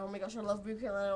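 fast, tumbling gush; rushed. Real, not acted. Fan gushing about Brief Carolina, fast rushed words. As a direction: blurt it out in a rush.

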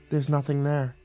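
soft, mildly firm assurance. A narrator's reading. Nymph convincing dazed guard, firmly. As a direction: steer him off with quiet certainty. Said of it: "said firmly".

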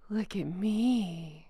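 soft, slow, low look at me; drawn-out smug murmur at the lens; mock smug. Mocking a royal posing for cameras, slow smug murmur.

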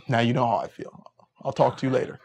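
ordinary delivery

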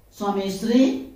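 ordinary delivery

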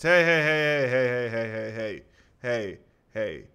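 mock-offended heys; indignant protest. Real, not acted. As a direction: protest then trail off. Protesting friend's joke.

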